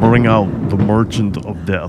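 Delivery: low, menacing Russian accent; Russian accent, sinister villain; creepy Russian accent. Shown as a demonstration. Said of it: "dude, that's creepy".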